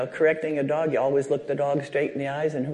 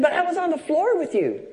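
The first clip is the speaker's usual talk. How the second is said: put-on child voice; 13 semitones higher, 4 dB louder than usual. Child voiced in retold story.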